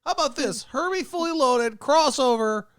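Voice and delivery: high-pitched producer savoring the pitch; slow high studio boss voice; high mock exec, slowing. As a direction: sell the crossover slowly. Mocking studio executive.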